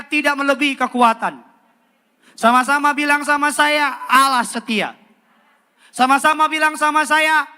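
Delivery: loud, high, fervent sermon; preaching at full force. Acted. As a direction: drive it home to the whole church.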